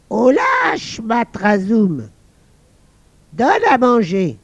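high loud call, drawn out; old men calling. Acted. Old men ordering the invisible servant, loud call. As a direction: bellow for the servant. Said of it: "deux vieux entrèrent et dirent".

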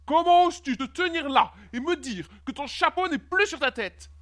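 high-pitched, indignant king; trembling with anger. A narrator's reading. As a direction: scold as an outraged monarch. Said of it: "tremblant de colère". (roi outré, tremblant de colère)

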